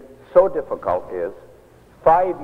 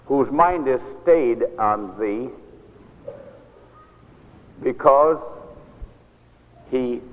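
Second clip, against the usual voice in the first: slow scripture reading; slower than usual. Retold or imitated. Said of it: "Here it is. Carolyn, look at it".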